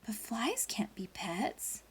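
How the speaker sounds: soft whisper of doubt. Acted. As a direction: whisper it as an aside. Judges voiced, whispered.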